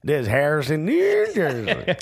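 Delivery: a mock old-timer drawl; put-on accent. Joking about Harrison locals, slow put-on accent. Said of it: "I don't know what accent that is".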